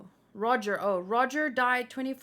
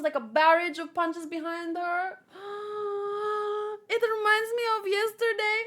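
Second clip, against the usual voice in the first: usual speaking voice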